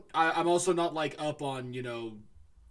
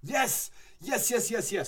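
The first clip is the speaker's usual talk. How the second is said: excited yes; 7 semitones higher, slower than usual. Real, not acted. Excited recognition of the yokai.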